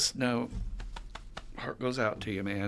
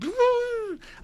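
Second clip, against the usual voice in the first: put-on childish giggle; 5 dB louder than usual. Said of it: "if you see any normal child".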